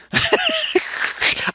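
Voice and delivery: a sudden high burst of laughter; real laugh. Real, not acted. Baffled by a strange word.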